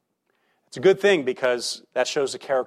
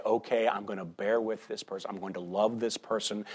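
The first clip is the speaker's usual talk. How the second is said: softer, lower, patient and gentle; quiet resolve; 5 semitones lower, 8 dB quieter than usual. Retold or imitated. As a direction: promise yourself to bear with them. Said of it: "Be able to say".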